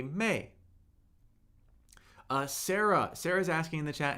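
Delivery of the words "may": "may" falling from high; fourth tone. Shown as a demonstration. Teacher cycling the tones with a syllable, "may" falling. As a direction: start "may" high and drop it quickly. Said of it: "and the fourth tone".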